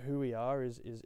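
usual speaking voice

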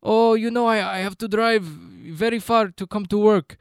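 a hammed-up Ukrainian accent, slow and mournful. Voicing the Ukrainian guy.

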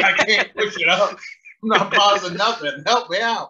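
stuck lifter pleading. Retold or imitated. Lifter stuck under the bar begging the spotter, laughing. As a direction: beg for help, half laughing.

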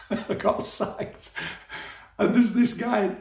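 slow, breaking up with laughter; helpless chuckle, words half lost; laughing. Real, not acted. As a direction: laugh your way through the confession. Laughs at own sacking.

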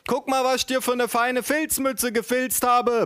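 very high, gushing mother voice; mock yell, sustained high pitch; bellowing mother. A narrator's reading. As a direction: crow it at a baby in a high voice. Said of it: "brüllte meine Mutter".

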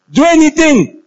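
high, loud cry of do anything; mock exhortation. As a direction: ham up the corrupt advice. Mocking worldly advice.

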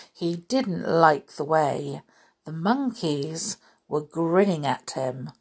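usual speaking voice

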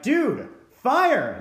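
high, loud retold warning; urgent call-out, acted; alarmed shout. Frantic warning to passing chef.

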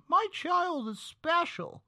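high, slow whiny mother voice; mocking parent voice. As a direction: whine it like a doting mother.

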